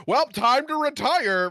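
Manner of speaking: high, slow, relieved sigh of a line; relieved old king. Retold or imitated. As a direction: play a tired king ready to retire. Greymane acted walking into work.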